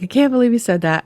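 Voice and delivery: high, loud, fast demo phrase; voiced high and loud for the demo; demo tapping phrase. Shown as a demonstration. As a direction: say it out high and quick. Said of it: "It's very gentle".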